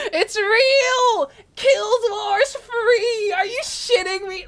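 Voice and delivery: high, tearful burst of joy; nearly crying with happiness, drawn out; tearful excited cry. Real, not acted. Trailer confirmed, near tears.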